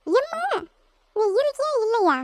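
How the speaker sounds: high-pitched call for mother; exasperated calling out. Acted. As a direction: call out to mom in complaint. Calls mother during sisters' quarrel.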